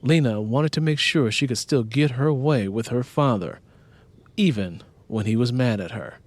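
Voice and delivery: plain reading voice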